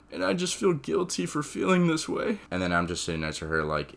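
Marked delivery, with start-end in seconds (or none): 0.1-2.4 grieving mother. Retold or imitated. Mike's mom confessing guilt.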